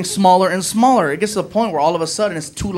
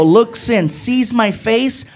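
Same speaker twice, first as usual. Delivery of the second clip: normal talking voice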